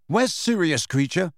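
high, urgent demand. Acted. Harry demanding answers from the house elf, urgent. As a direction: insist on an answer now. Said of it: "Harry demanded".

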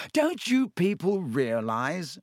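high, slow, patronizing voice; Lockhart, slow condescension. A narrator's reading. Lockhart lecturing the class, slow and condescending. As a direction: talk down slowly, as to the dim. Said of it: "speaking slowly as though they were all a bit dim".

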